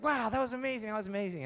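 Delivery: high, amazed gushing; freaking-out crowd. Acted. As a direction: gush like an awestruck onlooker. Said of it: "everyone's freaking out".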